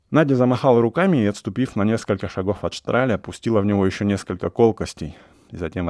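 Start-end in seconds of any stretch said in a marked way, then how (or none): none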